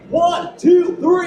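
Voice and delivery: loud, high count-off to rally the crowd; big crowd count-in; shouted count. Real, not acted. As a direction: shout the count to pump them up.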